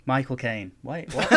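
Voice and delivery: normal talking voice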